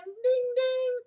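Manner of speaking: high sung ding ding like a bell; bell sound. A narrator's reading. Reading to a child, imitating the bell's high ding. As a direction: ring it out like a little bell.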